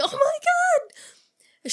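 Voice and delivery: loud, high, drawn-out mock scream; horrified scream. Sid seeing a knife in Dewey's back, horrified shriek. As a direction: wail it slowly in hammed-up horror.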